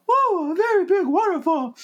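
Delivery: very high, squeaky little piglet voice. Retold or imitated. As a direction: squeak it like a tiny frightened pig. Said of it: "It's Piglet in".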